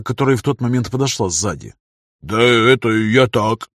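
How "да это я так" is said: slow, drawn-out evasive excuse; stretched-out, shifty backpedalling; wriggling out. Acted. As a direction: stall as you dodge the question. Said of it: "начал юлить Моня".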